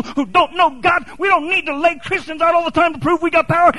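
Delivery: high, driving preacher's cry; full force preaching. Acted. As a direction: preach it out like a call to the streets.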